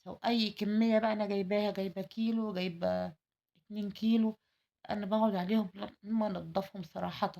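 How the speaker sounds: normal talking voice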